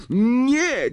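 high-pitched cry of protest; shrill, alarmed exclamation; screamed refusal. A narrator's reading. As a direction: cut in with a piercing no. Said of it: "krzyknęła Hermiona".

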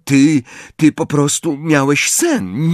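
hesitant, faltering a little; unsure of what to say; uncertain tone. A narrator's reading. Ron telling Harry it was a dream, hesitant and unsure. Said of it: "powiedział Ron niepewnym tonem".

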